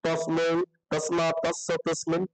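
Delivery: low, slow chant of the declension; slow rote recitation. Shown as a demonstration. Teacher mimicking students reciting by rote, low and slow. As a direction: drone the forms as if reading by rote. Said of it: "আর শুধু যদি পড়ো কি?".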